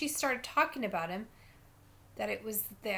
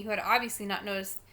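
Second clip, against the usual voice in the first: plain reading voice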